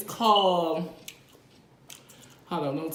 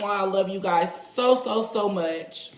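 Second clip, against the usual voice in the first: ordinary delivery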